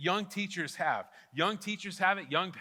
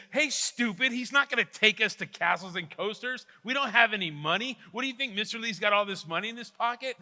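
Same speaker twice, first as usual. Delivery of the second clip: higher, louder scolding schoolgirl; sassy girl; 5 semitones higher, 5 dB louder than usual. Retold or imitated. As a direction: put the classmate in his place with sass. Girl mocks classmate's silly idea.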